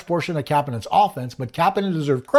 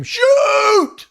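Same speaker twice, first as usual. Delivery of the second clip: high, drawn-out shout; mock scream; 6 dB louder than usual. Frustrated fan yelling at the TV, mock scream. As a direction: scream it at the TV, holding the vowel. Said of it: "look at the T V and scream".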